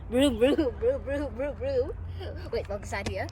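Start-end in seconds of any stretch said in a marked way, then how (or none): 0.1-1.9 rising engine vroom sounds; motorcycle noises. Retold or imitated. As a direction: imitate a revving motorbike. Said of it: "Shit, a motorcycle".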